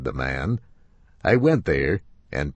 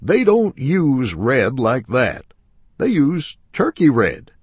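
lecturing upholsterer; 7 semitones higher, 6 dB louder than usual. Acted.

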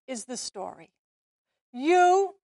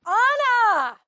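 calling out; 7 semitones higher, 5 dB louder than usual. Real, not acted. Director calling out to Anna.

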